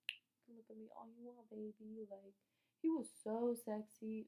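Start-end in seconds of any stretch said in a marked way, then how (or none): 0.1-2.0 much softer, teasing murmur; flirty murmur. Retold or imitated. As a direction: flirt back under your breath. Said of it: "I was just like".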